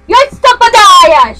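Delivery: slow, loud and high mock yell at the kids; grandma yelling. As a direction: belt it out like an Italian grandma calling. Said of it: "she'd be yelling at us kids".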